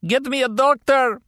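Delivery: very high, loud cry of pain; agonized cry. A narrator's reading. Captive with shattered knee begging for a doctor, agonized. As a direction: cry out for help in agony. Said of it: "he cried out in agony".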